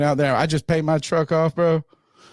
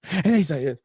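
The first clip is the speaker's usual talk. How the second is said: usual speaking voice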